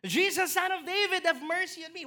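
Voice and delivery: high, loud plea; a blind man crying out for mercy, loud and high. Retold or imitated. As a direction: call out to Jesus, begging. And he cried out.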